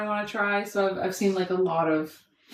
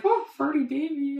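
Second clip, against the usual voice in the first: put-on higher-pitched playful baby talk; cooing to baby; 6 semitones higher than usual. Mum teasing her gassy baby, cooing. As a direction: coo teasingly at your baby. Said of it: "I was just like".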